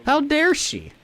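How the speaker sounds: high, indignant how dare she; mock offended squeak; mock outrage. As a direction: play at being offended. Mock offense at wife's joke.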